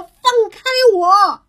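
high, drawn-out storybook shout; trapped hare's high, slow holler. Acted. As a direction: yell to be let loose, stretching the words. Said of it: "他大喊着说".

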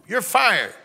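mock Trump firing. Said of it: "Spirit of Trump come on him".

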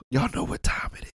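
breathy hushed intro line; whispered show catchphrase. Acted. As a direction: whisper it like a secret signature.

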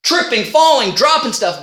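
usual speaking voice

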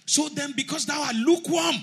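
high, loud preaching shout; shouted scripture. Real, not acted. Preacher shouting the verse at the congregation, high and loud. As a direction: cry it out like a warning.

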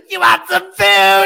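slow, loud mock taunt; hammed-up brawl taunt; mock yell. Imitating the food-fight taunt in a fight. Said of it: "that's just what I'm gonna get".

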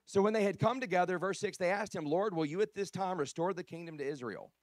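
loud, emphatic scripture reading; preaching scripture. Real, not acted. Preacher reading Acts to his congregation, loud and emphatic. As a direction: proclaim the verse forcefully to a congregation.

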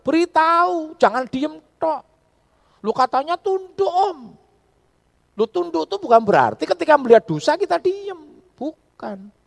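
high, loud, impassioned sermon shouting; fiery preaching. Acted. Pastor urging couples to speak up, shouting from the pulpit. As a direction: drive the rebuke home to the congregation.

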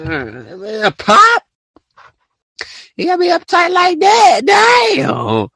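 high, whiny mock outrage with a crying edge; exaggerated high disbelief ending in a yelp; mock exasperated. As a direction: whine it like you can't believe someone is this uptight. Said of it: "I'm like".

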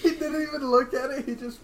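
laughing. Real, not acted. Cracking up over a friend's card mistake, laughing. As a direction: talk through real laughter.

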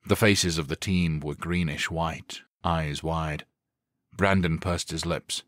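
usual speaking voice

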